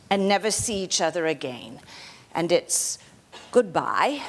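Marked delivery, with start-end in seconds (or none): none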